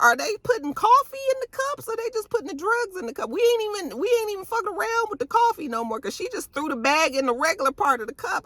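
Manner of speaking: high, fast, disbelieving voice; incredulous mock reaction. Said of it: "I was like".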